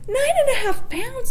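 mother hearing the huge weight estimate, shocked and high; shocked disbelief. Retold or imitated. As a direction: gasp it back as if the number can't be real. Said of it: "So I lost it".

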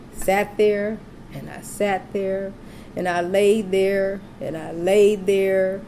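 slow, dragging repetition; drawn-out waiting. Retold or imitated. Mother recalling hours in labor, slow and drawn out. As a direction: stretch each phrase to show endless waiting. Thirty-hour wait drawn out.

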